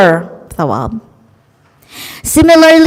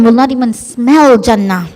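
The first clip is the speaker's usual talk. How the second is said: normal talking voice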